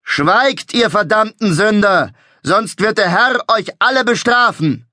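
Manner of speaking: high, loud preacher's thunder. A narrator's reading. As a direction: thunder it down at the sinners. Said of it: "rief mit donnernder Stimme".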